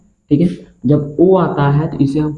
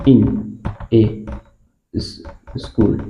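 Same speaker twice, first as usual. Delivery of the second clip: slow fading dictation; 4 semitones lower, much slower than usual. Shown as a demonstration.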